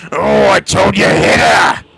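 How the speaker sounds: lautes Orc-Brüllen; brüll den Befehl heraus; orc shout. Acted. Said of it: "the orc goes".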